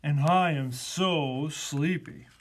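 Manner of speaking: slow, drawn-out sleepy elephant; slow weary animal voice. A narrator's reading. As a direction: stretch it out like a tired elephant. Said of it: "said the elephant".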